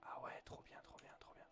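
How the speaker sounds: hushed excited whisper. Real, not acted. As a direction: whisper it while sneaking. Sneaking, whispers to himself.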